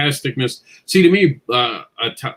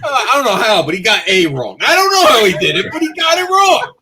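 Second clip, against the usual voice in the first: much higher, talking through laughter; laughing disbelief; 10 semitones higher, 5 dB louder than usual. Real, not acted. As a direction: laugh while you tell it. Laughing about friend's typo.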